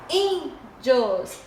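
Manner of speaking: "índios" split, strong on ÍN; "índios" in two separate syllables, first one stressed. Shown as a demonstration. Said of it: "tem mais intensidade".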